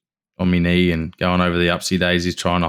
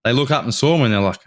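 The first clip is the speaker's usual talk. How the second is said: ordinary delivery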